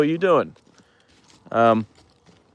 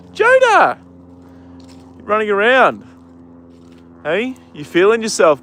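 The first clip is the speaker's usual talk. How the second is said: much higher, louder sing-song call to the horse; 9 semitones higher, 5 dB louder than usual. Real, not acted. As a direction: call out to your horse, pitched way up. Calling greeting to his horse.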